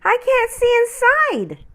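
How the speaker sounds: very high, small, curious voice; little ornament voice. Acted. Ornament Noel asks the big tree, high and curious. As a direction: ask like a tiny wondering ornament. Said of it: "Noel asked".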